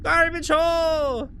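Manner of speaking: high, drawn-out exclamation; excited character shout. Acted. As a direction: announce it high and slow. Character calls out in game.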